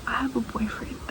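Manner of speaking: whispered admission to her father; whispered confession. Retold or imitated. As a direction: barely breathe it out, scared to say it. Confessing boyfriend to angry dad.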